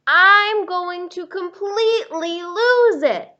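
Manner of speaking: about to snap, hammed up; mock exasperation. Purple Crayon warning Duncan, mock frustrated outburst.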